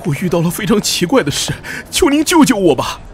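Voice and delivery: high, loud, frantic begging; desperate plea. Acted. 陌生人向黑羽大人求救，惊慌高声哀求. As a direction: throw yourself on their mercy. Man begs for rescue.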